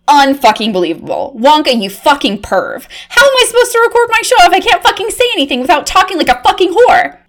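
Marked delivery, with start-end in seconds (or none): 3.1-7.2 high, fast exasperated rant; frantic rant. Acted. Reporter ranting at Wonka about her ruined show, fast and high. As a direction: spill it all out in a panic.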